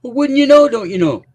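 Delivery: high, lilting Minnesotan caricature; loud hammed-up Minnesotan; mock Minnesota accent. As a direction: sing out the Minnesota accent, teasing. Mocking the denied Minnesota accent.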